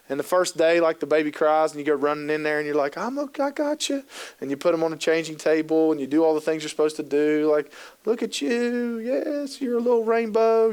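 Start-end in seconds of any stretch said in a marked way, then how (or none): 3.0-4.0 high, soft coo to a newborn; sing-song parent reassuring the infant; soothing baby talk. Retold or imitated. Dad soothing crying newborn. 8.0-10.7 put-on high cooing at a baby; doting high sing-song baby voice; baby talk.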